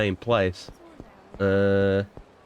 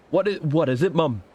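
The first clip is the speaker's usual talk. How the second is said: pitched-up mock Liverpool accent; 6 semitones higher than usual. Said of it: "doing the fucking Beatles impression".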